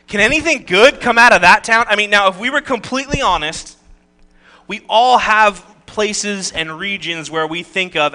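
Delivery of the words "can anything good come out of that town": mock-incredulous question, high and loud; incredulous scoffing. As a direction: act out a loud, disbelieving scoff. Nathanael scoffs at the town.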